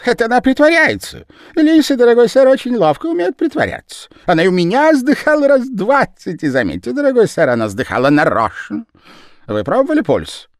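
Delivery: very high, loud, rushed patter; fast-talking Japanese man. A narrator's reading. The Japanese man pattering at the gentleman, high and loud. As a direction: talk him round in a rush.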